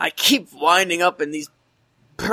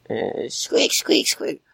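mouse squeaks; 3 semitones lower, 3 dB quieter, much slower than usual. Acted. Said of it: "project a noise of a mouse".

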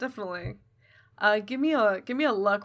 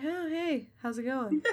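softer, slightly lifted offhand hello; casual greeting; 6 semitones higher, 6 dB quieter than usual. Acted. Jiro greets freaking-out Yuki, softly casual. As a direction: play it cool and quiet, voice a little up. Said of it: "he's just like".

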